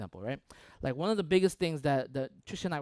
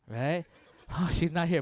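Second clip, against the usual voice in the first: ordinary delivery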